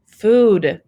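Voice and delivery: loud, eager blurt; perked up. Acted. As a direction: jump at the word food. Boy perks up at breakfast.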